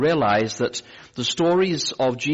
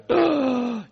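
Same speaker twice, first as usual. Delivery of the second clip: gasp. Retold or imitated. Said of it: "gasping for breath".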